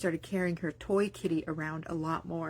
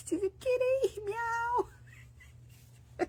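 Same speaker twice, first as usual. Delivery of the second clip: cute kitty voice; much slower than usual. Retold or imitated. Imitating her baby's kitty talk.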